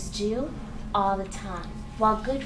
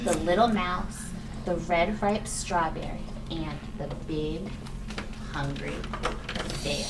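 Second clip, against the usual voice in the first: lower, storybook title reading; reading to a toddler; 5 semitones lower, 3 dB quieter than usual. Acted. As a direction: read the title to little ones. Reading book title to kids.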